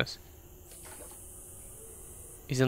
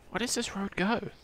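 normal talking voice

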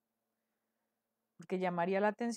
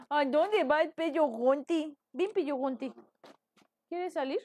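higher, louder coo to a little pet; pet talk; 6 semitones higher, 5 dB louder than usual. Real, not acted. Streamer coaxing her pet to come out, high playful coo.

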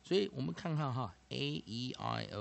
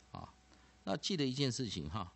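normal talking voice

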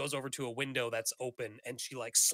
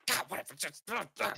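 mildly hammed-up old woman's huff; half-formed irritated ranting, lightly acted; mock cranky grumbling; 7 semitones higher than usual. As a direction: grumble dismissively, rising higher. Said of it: "she's just yelling and cursing".

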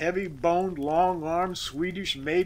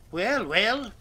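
higher, impatient urging; prodding; 6 semitones higher, 4 dB louder than usual. A narrator's reading. Boss to squirming worker, prodding. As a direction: nudge him to get on with it. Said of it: "prodded Regan".

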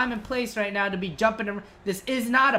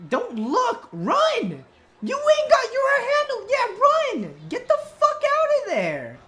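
high, loud frantic orders; urgent shouting; 5 dB louder than usual. Real, not acted. Player shouting at his companion to get away, urgent. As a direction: yell at them to run. Urging companion to flee the cutscene.

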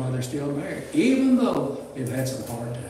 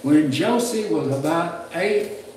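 ordinary talk, nothing marked

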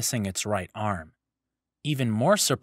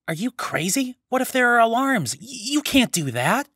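much higher, louder frantic protest; panicked Matoran; 13 semitones higher, 5 dB louder than usual. Acted. Takua begging the Toa not to break the case, panicked and much higher. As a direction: squeal it in alarm, grabbing his arm.

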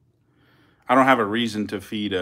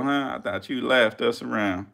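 ordinary delivery

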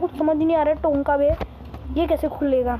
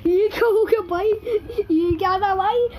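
shocked whine; 7 semitones higher than usual. Real, not acted. Sudden death in game.

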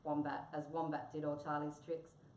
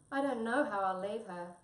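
raised, loud, mild lament; sadly; 5 semitones higher, 7 dB louder than usual. A narrator's reading. Tabby grieves over leaving the lioness, mildly sad. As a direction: lament it with a touch of drama. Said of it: "said Tabby sadly".

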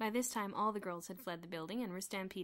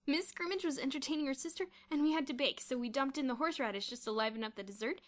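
raised, giddy telling; mild hysteria; 5 semitones higher, 5 dB louder than usual. A narrator's reading. Kathy explaining the baking mishap, mildly hysterical. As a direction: tell it as if half hysterical. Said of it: "still hysterical".

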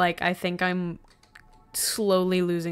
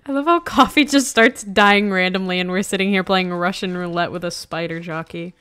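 usual speaking voice

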